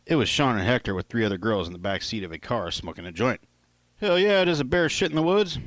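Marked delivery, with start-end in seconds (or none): 4.0-5.6 high, loud playful retort; cocky party joke; joking. Acted. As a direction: toss it off like an obvious joke. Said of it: "I replied jokingly".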